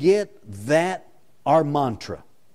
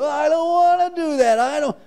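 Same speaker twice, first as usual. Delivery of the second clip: high, loud sulky whine; high mock whining; whining to the Lord; 12 semitones higher, 4 dB louder than usual. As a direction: whine like a reluctant child. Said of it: "we cringe at the complaint".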